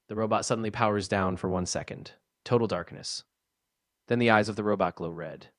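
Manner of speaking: ordinary delivery, nothing marked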